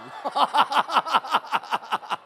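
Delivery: big loud laugh; loud burst of laughter. Real, not acted. Preacher laughs loudly at the smug older brother.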